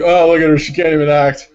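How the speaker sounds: loud mock jeer at an actress; mocking aside. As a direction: play the director loudly ribbing her. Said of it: "he starts talking to the guy beside him".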